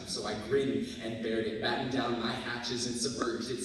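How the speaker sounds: driving, rhythmic spoken-word story; slam poetry. Acted. Student recounting a third grade teacher's punishment, slam poetry. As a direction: press through the lines, easing for key images.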